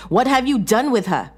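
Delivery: high, loud desperate demand; angry demand. A narrator's reading. John to his wife, loud desperate demand. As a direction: force the answer out of her.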